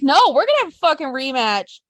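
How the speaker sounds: angry wrestler demanding a rematch; pissed-off retort. Retold or imitated. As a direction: snap back at the boss. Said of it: "they get pissed by this question".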